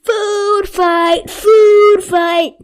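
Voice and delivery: loud high excited yell; shouting. A narrator's reading. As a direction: yell it to start the chaos. Narrator yells food fight.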